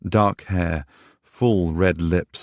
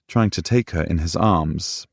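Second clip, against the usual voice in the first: ordinary delivery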